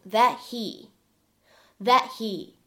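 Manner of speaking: "that he" linked, the t of "that" unreleased; incomplete plosive. Shown as a demonstration. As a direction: hold back the t before "he" in "that he". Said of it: "又会有一个不完全爆破的处理". ("that he"不完全爆破，t不完全发出)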